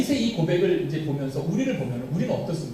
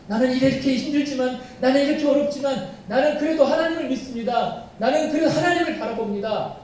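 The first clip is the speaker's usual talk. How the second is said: higher, earnest vow of faith; fervent confession; 7 semitones higher than usual. Acted. Believer confessing faith in trouble, raised and earnest. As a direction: declare trust through the hardship.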